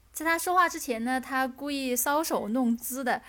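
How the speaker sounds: normal talking voice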